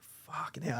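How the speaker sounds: whispered, breath only; barely-there shocked whisper; stunned whisper. Retold or imitated. Whispering in shock at Billy's news.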